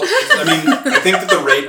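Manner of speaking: talking through laughter; laughing. Real, not acted. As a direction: laugh while you speak. Laughing mid sentence.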